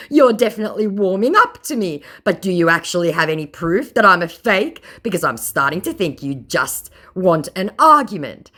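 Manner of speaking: mocking scammer voice. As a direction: read it like a pompous fraud bragging.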